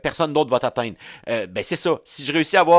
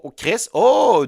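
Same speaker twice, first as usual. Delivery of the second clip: excited realization; 9 semitones higher, 5 dB louder, much slower than usual. Real, not acted. Sudden realization, drawn out.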